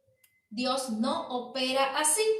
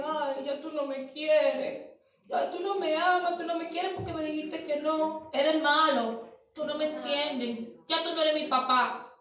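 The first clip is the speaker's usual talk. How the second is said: put-on whiny child; 2 semitones higher than usual. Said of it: "lloran y dicen".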